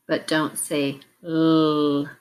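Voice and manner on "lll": l as a long held "lll", not "el"; isolated consonant sound. Shown as a demonstration. Teacher naming the sound to delete, l said as a drawn-out "lll". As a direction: hold the l sound alone. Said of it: "which sound to delete".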